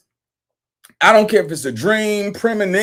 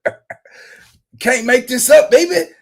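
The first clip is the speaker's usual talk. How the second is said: ordinary delivery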